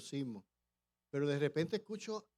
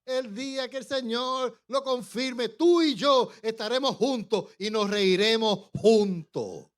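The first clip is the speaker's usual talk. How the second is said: excited promise; 7 semitones higher, 9 dB louder, slower than usual. Retold or imitated. Quotes laughing teacher's promise.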